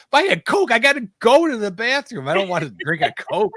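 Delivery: high, loud mock outrage; indignant protest. Traveler desperate for the bathroom is told to buy, indignant protest.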